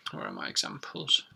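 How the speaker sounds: under-the-breath aside, searching notes; muttered to herself. Real, not acted. Teacher searching her notes, muttering softly to herself. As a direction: mutter it to yourself while hunting for papers.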